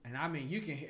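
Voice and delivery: usual speaking voice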